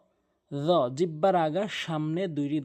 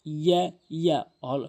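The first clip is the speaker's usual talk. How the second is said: ordinary delivery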